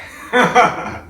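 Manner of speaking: a bright burst of laughter at his own joke; short high laugh. Real, not acted. Preacher laughing at his crow joke with listeners, short laugh. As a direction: laugh out at the punchline.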